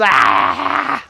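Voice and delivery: high hammed-up yelp; mock scream. Reviewer imitating the villain's scream, mock yelp. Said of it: "And he just goes".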